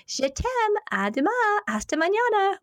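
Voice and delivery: very high, singsong goodnight; cute bedtime farewell. Retold or imitated. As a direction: trill loving foreign goodnights like mom. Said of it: "it was just this cute way".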